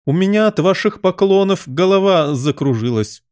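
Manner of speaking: high, exasperated royal complaint; irritated yell. A narrator's reading. Queen to bowing gardeners, yelling irritably. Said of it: "завопила королева".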